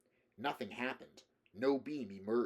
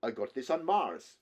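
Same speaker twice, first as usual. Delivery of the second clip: put-on louder, hearty Slavic-accented voice; 2 semitones higher, 6 dB louder than usual. A narrator's reading. Russian man boasts of his gun to Rick, Slavic accent. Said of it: "the man asked with a Slavic accent".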